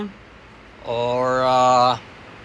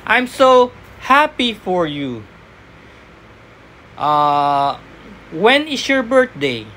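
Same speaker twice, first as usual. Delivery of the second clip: cheerful sample line; slower than usual. Shown as a demonstration. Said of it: "lalagyan nila ng question".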